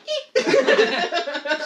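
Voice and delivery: wheezing high laughter; helpless fit of laughter. Real, not acted. Laughs at the slap.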